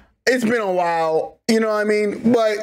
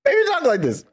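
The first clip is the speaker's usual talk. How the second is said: mock villain voice; 8 semitones higher than usual. Reactor trying out the villain's voice, lightly mocking. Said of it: "maybe he does talk like this".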